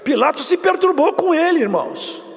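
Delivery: high, loud full-force preaching to the congregation; fiery preaching. Acted. Pastor preaching the suffering of Jesus, loud and high.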